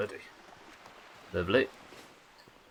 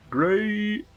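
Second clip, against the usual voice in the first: much higher, louder, held long; high drawn-out cry; 13 semitones higher, 4 dB louder than usual. Pretend. Player playing on Captain Grayson's name, high-pitched cry. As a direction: squeal the word and stretch it. Playful echo of own last word.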